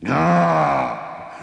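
high, strident giant's howl of rage; enraged cry. A narrator's reading. Cheated giant explodes with a cry of rage. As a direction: let out an exasperated high cry. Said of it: "Le géant explosait de rage".

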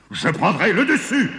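high-pitched, gloating giant voice; sneering giant. A narrator's reading. Giant picks his half of the harvest, sneering. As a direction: snicker it like a crafty giant. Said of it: "ricana le géant".

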